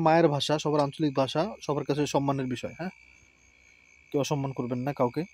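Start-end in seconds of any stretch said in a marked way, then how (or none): none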